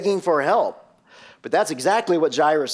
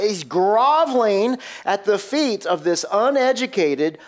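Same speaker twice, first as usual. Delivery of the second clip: higher, incredulous mock gossip that fades at the end; scandalized religious friends; 5 semitones higher than usual. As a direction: play the shocked elders, half-hearted. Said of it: "what were Jairus' religious friends thinking".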